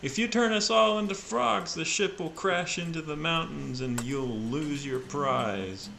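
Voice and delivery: high loud shout; yelling back. Acted. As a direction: yell it back across the deck. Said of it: "he will yell back".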